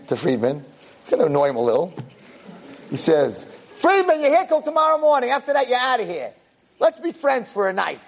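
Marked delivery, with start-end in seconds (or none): 3.8-8.0 high-pitched pestering appeal; insistent friendly nudge; acted coaxing. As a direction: call over to a grumpy roommate, pitched up. Pestering grumpy roommate with friendly offer.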